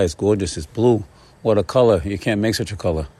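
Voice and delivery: ordinary talk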